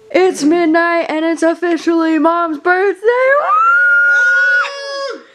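loud, high celebratory shout; cheering at midnight for mom's birthday; party cheer. Real, not acted.

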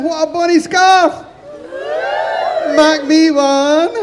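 high, admiring shouts from the street; excited crowd calling out to the tailor; crowd shouting. A narrator's reading. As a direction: call out high, then stretch the last words long. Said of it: "everybody was shouting".

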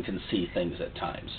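ordinary talk

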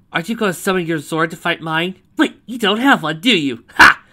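loud, high mocking jeer; gloating taunt. A narrator's reading. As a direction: rub it in, ending on a loud ha. Gloating over rival who lacks a robot.

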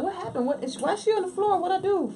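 high, bewildered panicked voice; mock-bewildered, breaking into a laugh; confused self in blackout. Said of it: "I'm like".

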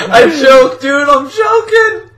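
high, drawn-out cry of self-reproach; frustrated wail. Real, not acted. Player losing the lead, frustrated wail. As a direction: wail at your own failure. Lamenting own failure.

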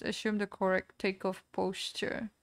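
ordinary delivery

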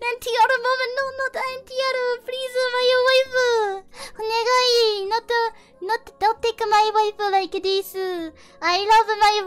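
mock pleading. Mock pleading for his wife.

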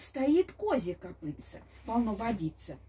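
usual speaking voice

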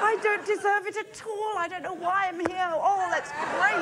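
high-pitched, effusive self-abasement; mock humble gushing. Guest demonstrates losing gracefully, exaggerated humble delight. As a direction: grovel gladly in the winner's shadow, overacted. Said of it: "I'm brilliant at going".

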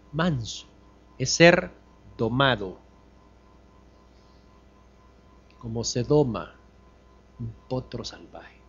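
slow, with long pauses between phrases. Shown as a demonstration. Preacher repeats to the congregation, slowly and deliberately. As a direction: spell it out slowly so it sinks in. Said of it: "Se lo voy a repetir más despacio".

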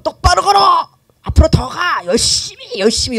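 loud, high drill-style commands; barked orders. Acted. The world pushing people to try harder, barked orders. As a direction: drive them on with mock-harsh shouts. Voices the world's harsh demands.

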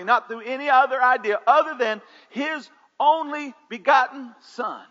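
loud, high fervent preaching. Acted. Pastor preaching loudly to the church.

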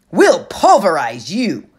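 loud, slow, husky bully threat. Acted. Clarice threatens Annabeth's team, loud husky menace. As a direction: lean in and menace. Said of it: "Clarice said".

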